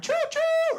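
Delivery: high sung choo-choo train whistle. Pretend. Comedian mimicking a train whistle for the punchline, high sing-song toot.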